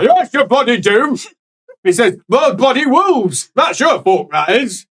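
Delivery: hammed-up grumpy priest voice; gruff angry old priest. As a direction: bellow like an outraged old cleric. GM voices angry Father NPC.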